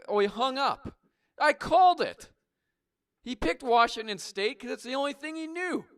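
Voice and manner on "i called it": triumphant gloat. Acted. Gloats after caller hangs up.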